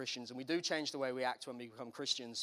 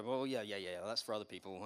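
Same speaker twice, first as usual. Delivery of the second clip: dismissive shrug; 7 semitones lower, 5 dB quieter than usual. Retold or imitated. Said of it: "just to move quickly on ... and go".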